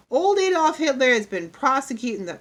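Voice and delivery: put-on boy's loud classroom oration; reciting schoolboy. A narrator's reading. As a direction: declaim it like a pupil giving his report. Boy recites current event.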